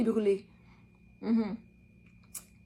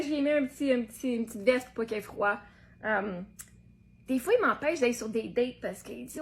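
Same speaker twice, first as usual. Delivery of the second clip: ordinary delivery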